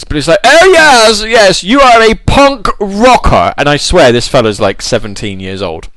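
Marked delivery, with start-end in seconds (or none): 0.4-3.5 high, slow, camp posh voice; loud swooping mock-aristocrat; hammed-up old-fashioned upper-class accent; effeminate toff. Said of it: "who speaks like that these days".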